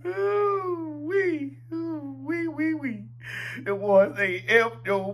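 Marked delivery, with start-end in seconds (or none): 0.1-3.0 high-pitched laughing ooh wee. Real, not acted. Vlogger laughing at the chant, squealing ooh wee. Reacting to the crowd video.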